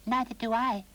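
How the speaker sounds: a high, sniffy doll; high, loud sniffy doll voice. A narrator's reading. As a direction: sniff it out disdainfully. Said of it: "sniffed the doll".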